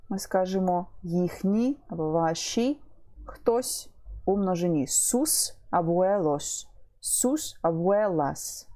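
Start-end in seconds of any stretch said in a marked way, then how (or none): 4.8-8.7 slow, even reading of «Sus abuelos, sus abuelas»; slow model reading. Shown as a demonstration. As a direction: say «Sus abuelos» and «sus abuelas» very slowly, the way a teacher sounds it out for a class. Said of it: "teacher slowly models plural". (повільно, по складах, як учитель для класу)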